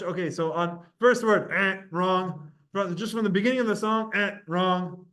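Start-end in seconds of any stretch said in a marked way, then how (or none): 1.5-1.8 short buzzer "eh"; abrupt buzzed noise; wrong-answer buzzer. Shown as a demonstration. 4.1-4.4 short buzzer "eh"; abrupt buzzed noise; wrong-answer buzzer. Shown as a demonstration.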